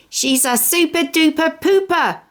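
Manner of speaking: put-on proud and giggly, like a toddler telling tales; smiling small boy. Cheese points out the smear to brother, high and smiling. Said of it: "Cheese smiled".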